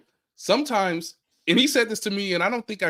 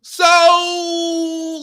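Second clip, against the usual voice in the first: loud burst on so; sudden shout; 9 semitones higher, 8 dB louder than usual. Real, not acted. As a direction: blurt it loud.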